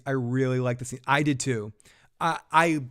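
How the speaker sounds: normal talking voice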